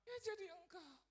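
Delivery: very high, gentle mom voice; gentle mother. Acted. Mother answering her worried son, gently. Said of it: "Mama saya dengan lembut bilang".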